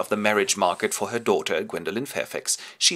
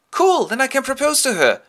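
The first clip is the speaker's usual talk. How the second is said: much higher and louder, thrilled; eager; 7 dB louder than usual. Acted. John thrilled that Gwendolen is coming, eager. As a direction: jump at the chance.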